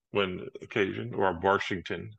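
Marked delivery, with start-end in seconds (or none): none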